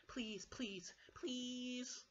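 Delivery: high, squeaky cub pleading; begging. Acted. Baby Bear begs parents for a chair, high pleading. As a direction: plead with each word, stretching the last. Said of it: "begged Baby Bear".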